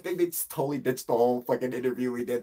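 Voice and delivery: usual speaking voice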